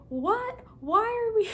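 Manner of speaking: high, startled what; confused surprise. Retold or imitated. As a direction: play dumb with a squeaky high question. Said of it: "here I am going".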